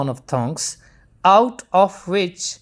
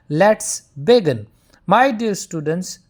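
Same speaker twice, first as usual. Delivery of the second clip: ordinary talk, nothing marked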